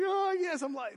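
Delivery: high-pitched exaggerated fake wail; mock crying. Lost boy crying for mom, hammed-up wail. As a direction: imitate a kid crying his head off. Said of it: "and I started crying".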